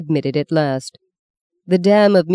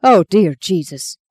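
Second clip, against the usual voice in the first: horrified; 3 semitones higher, 4 dB louder, slower than usual. Acted. Horrified at own words.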